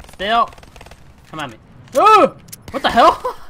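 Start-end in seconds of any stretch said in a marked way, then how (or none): 2.0-3.4 high, loud startled cry; surprised yelp. Real, not acted. Gamer shot out of nowhere, startled yelp. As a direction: caught off guard, yelp it. Gets shot suddenly in game.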